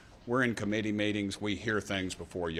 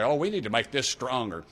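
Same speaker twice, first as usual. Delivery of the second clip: mock indignation, raised and louder; put-on outrage, pitched up; righteous indignation; 6 semitones higher, 6 dB louder than usual. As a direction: lightly imitate senators huffing with righteous indignation. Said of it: "come back in righteous indignation and say".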